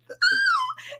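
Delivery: very high squeal of laughter. Retold or imitated. Mom recalls the nose, very high squealing laugh. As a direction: shriek with amused horror. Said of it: "And I was like".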